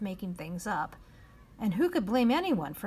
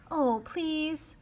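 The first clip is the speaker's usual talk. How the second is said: pleading; 8 semitones higher than usual. A narrator's reading. Omri pleading.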